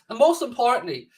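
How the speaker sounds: ordinary talk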